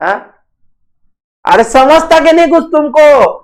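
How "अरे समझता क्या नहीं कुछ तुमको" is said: ordinary talk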